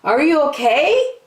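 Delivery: high, loud caring question; concerned monkey. Acted. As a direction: Jim checking on his friend. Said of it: "asked Jim".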